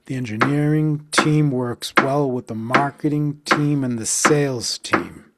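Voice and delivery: rhythmic stress delivery; counted stress beats. Shown as a demonstration. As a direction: pulse the key words. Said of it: "Let's count that".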